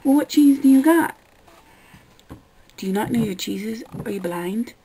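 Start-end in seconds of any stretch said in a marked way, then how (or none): 0.0-1.2 higher, louder mocking customer question; mock clueless customer. Server mocking a customer asking which cheeses, higher and louder. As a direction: parody an oblivious orderer.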